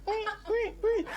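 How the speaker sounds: tiny high-pitched squeaks; soft, squeaky "wee" noises; imitated squeaking noise. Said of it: "they heard".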